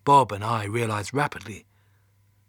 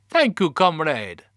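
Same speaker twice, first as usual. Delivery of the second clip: slow, higher Russian dog voice; terrible Russian accent; 5 semitones higher, 6 dB louder, slower than usual. A narrator's reading. Laika thanks the astronaut for biscuits, in a terrible Russian accent. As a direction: thank him in hammed-up bad Russian. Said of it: "That's a terrible Russian accent".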